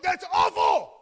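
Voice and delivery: loud, low, mock-horrified shout of "That's awful!"; sudden comic yell of dismay; shocked outburst. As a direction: blurt it out in hammed-up horror. Retold shocked reaction at reunion.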